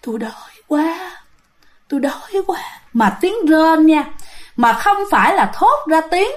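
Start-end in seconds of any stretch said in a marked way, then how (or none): 0.1-2.6 hungry ghost whispering breathily. Retold or imitated. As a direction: breathe the words out like a starving spirit. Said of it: "xong rồi kêu".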